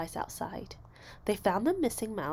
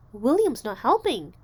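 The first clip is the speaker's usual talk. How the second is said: tattling; 3 semitones higher, 7 dB louder than usual. A narrator's reading. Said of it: "Jamaica told Missus McBee".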